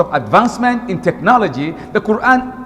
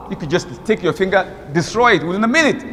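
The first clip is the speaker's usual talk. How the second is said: ordinary talk, nothing marked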